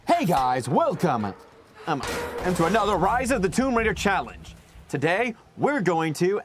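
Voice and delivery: peppy let's-play host intro; YouTuber intro voice. Retold or imitated. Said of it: "that kind of vibe".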